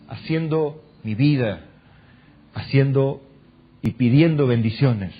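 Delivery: slow, low prayer with long pauses; model prayer. Acted. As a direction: pray it humbly, phrase by phrase.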